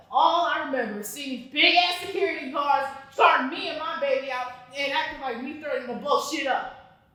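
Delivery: ordinary talk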